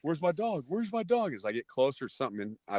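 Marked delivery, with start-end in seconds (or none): 0.0-1.3 taunting. Retold or imitated. Bully taunting on the way.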